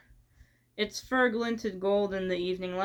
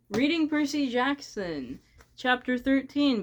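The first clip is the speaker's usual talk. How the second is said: big announcer read, pitched up; 5 semitones higher, 4 dB louder than usual. Pretend. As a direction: announce the title like a radio host. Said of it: "I sound like an announcer when I do that".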